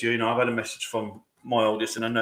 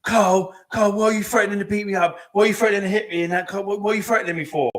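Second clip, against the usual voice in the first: higher mock whiny accusation; mimicking ex-partner; 7 semitones higher, 5 dB louder than usual. Imitating ex-partner faking fear on speakerphone, higher mock-pleading voice. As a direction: play the fake victim, pitched up and pleading. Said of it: "All of a sudden she went".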